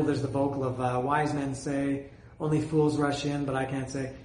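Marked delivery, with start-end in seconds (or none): none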